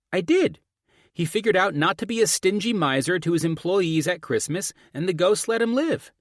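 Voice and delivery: put-on high, loud child arguing back; insistent daughter. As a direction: defend yourself like an indignant kid.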